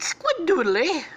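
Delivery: high, loud, drawn-out catchphrase; Squid character voice. Acted. Squid answering with his catchphrase, high cartoonish voice. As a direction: cry it out slowly, far above your own pitch.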